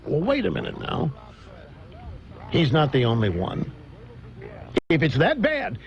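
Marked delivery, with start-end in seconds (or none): none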